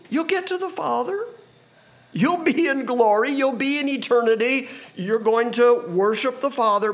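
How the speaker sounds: high, lifted exhortation; impassioned promise. Acted. Jesus promises Philip the way to the Father, impassioned.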